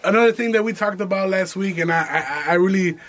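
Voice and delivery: ordinary talk, nothing marked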